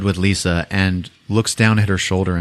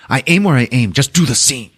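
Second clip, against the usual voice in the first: raised, mildly angry retort; mock yelling; 5 semitones higher, 3 dB louder than usual. Director answers his actress mid-scene, a mild mock yell. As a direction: imitate a director snapping back, half-yelled. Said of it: "He's fucking yelling at her".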